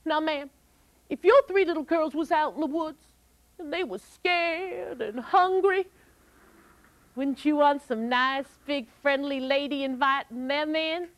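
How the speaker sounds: put-on high, coaxing girl voice; persuasive girl Molly. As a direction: sweet-talk the giant's wife.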